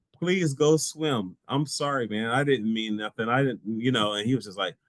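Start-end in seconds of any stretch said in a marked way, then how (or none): 0.1-3.6 high-pitched, hurried-to-placate apology; apologetic pleading. Retold or imitated. Apologising for calling before his swim, pleading. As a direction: plead lightly, pitched up, half amused. Retold apology to annoyed young man.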